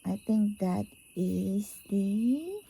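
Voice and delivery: plain reading voice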